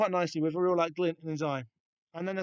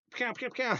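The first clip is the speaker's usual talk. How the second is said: mock gunfire; 8 semitones higher than usual. Imitating gunfire in the comic.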